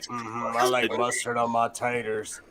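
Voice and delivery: low, soft country drawl; deep drawl. Retold or imitated. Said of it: "It wasn't more like".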